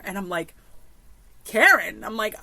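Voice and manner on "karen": loud, incredulous retort; indignant echo. Retold or imitated. Camper called a Karen by the girl, indignant retort. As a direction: throw the insult back, affronted.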